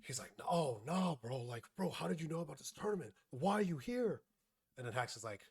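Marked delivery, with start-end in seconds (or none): 0.5-4.2 high-pitched mock protest in disbelief; retold crashing out. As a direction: act out a friend's panicked objection, pitch climbing. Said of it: "crashes the fuck out".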